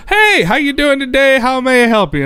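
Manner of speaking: high, bright customer-service voice; imitating a chirpy clerk; mock cheery clerk. Mocking the rental desk clerk's cheery greeting.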